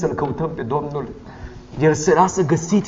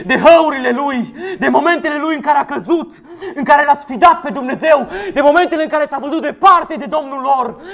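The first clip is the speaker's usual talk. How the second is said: raised, fast, fervent preaching with laughs; preaching at full force; 8 semitones higher than usual. Acted. Pastor rousing the congregation on complaining, fervent preaching. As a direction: hammer each "să se plângă" at the congregation.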